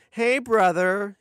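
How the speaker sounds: high, drawn-out loud greeting; friendly stretched character greeting; wheedling Oleg. A narrator's reading.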